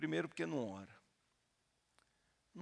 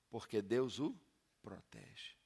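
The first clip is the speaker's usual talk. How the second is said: ordinary talk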